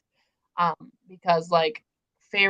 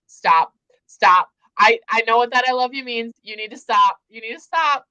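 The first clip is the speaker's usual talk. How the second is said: raised, louder protest, begging it to stop; retold reader's desperate plea, pitched up; mock pleading; 4 semitones higher, 7 dB louder than usual. Reader begging the book to stop, mock pleading.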